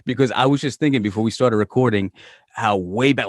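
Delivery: normal talking voice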